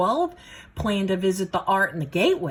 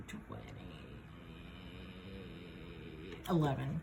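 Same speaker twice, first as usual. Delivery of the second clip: muttering; 13 semitones lower, 18 dB quieter, faster than usual. Real, not acted. Counting to herself under breath.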